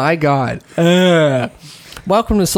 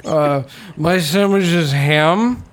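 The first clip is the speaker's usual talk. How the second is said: drunk imitation; slower than usual. Apparently Hayden's drunk.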